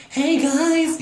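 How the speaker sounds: very high singsong greeting. Retold or imitated. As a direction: squeal it sweetly in head voice. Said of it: "you know how she sings".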